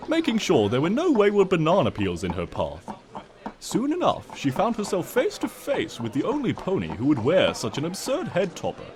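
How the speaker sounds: plain reading voice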